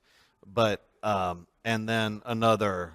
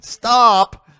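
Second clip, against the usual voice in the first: much higher, much louder "Stop."; mock command; 11 semitones higher, 10 dB louder than usual. Faye Allman telling the host to stop, loud. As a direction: play a listener loudly ordering him to stop. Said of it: "I can hear Faye Allman online".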